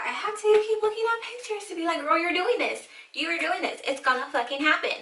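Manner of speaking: high, insistent hyping-up; self pep talk. Real, not acted. Talking herself into the haircut, high insistent pep talk. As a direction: pitch it up and urge yourself on.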